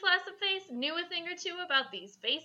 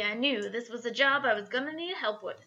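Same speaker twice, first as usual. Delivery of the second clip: ordinary talk, nothing marked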